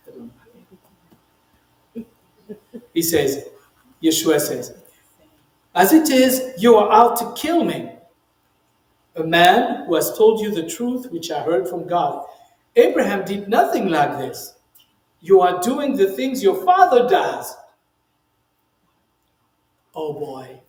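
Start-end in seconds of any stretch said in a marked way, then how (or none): 5.7-17.5 forceful scripture reading. A narrator's reading. Reading Yeshua's confrontational words forcefully.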